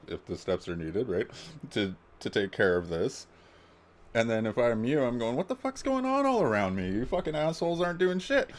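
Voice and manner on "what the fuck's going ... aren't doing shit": much higher, strained outraged yell; imitated anger. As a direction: play the furious neighbour losing it over masks. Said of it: "there's the anger".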